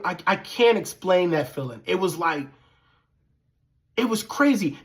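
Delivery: ordinary talk, nothing marked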